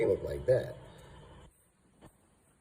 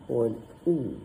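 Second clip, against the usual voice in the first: ordinary talk, nothing marked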